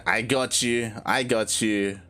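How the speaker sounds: ordinary delivery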